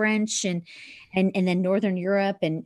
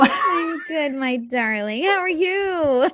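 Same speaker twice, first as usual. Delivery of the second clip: high, slow, sing-song hello; drawn-out gushing how are you; delighted greeting; 7 semitones higher, 4 dB louder, much slower than usual. Real, not acted. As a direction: stretch it out, thrilled to see her. Greeting her friend with delight.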